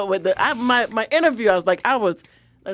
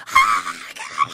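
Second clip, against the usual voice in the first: retold shriek. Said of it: "I was like".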